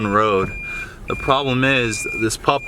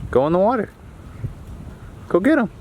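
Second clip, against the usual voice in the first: ordinary talk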